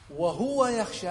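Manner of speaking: single out وهو يخشى with high, slow, loud stress. Shown as a demonstration. Teacher highlights second clause.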